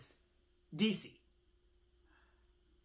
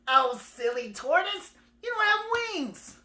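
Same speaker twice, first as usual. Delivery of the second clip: teasing bird; 11 semitones higher, 6 dB louder than usual. Acted. Bird tells silly tortoise he can't fly, high teasing. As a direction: tease it out, rising very high.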